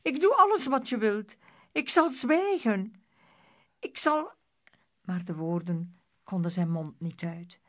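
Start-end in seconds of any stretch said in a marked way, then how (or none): 0.0-4.3 high, urgent begging; frantic promise, cut off at the end; pleading cry. A narrator's reading. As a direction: plead desperately in a raised voice. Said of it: "wilde Elvis roepen".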